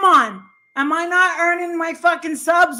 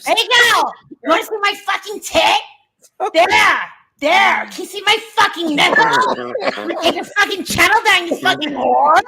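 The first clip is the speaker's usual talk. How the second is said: rising, faster shouting tirade; winding up into a hammed-up screaming fit; mock angry rant; 3 semitones higher than usual. Streamer rants at Justin for the chat, hammed-up fury.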